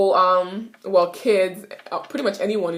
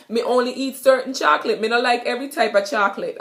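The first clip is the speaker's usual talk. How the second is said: Jamaican patois; 3 semitones higher, faster than usual. Shown as a demonstration. Teacher repeating the patois line for students. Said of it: "Basically, I said".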